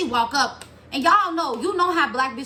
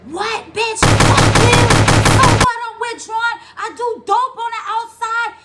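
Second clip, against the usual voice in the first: high, loud furious inmate; mock inmate yelling; 11 semitones higher, 8 dB louder than usual. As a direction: yell back at her like a cellmate. Inmates' angry retold yelling.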